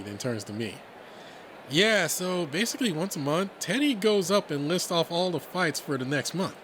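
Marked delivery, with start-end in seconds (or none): 1.7-6.6 higher, animated buddy voice; lively, raised-pitch explanation; friendly Redline explaining. Acted. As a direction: explain it breezily to a newcomer.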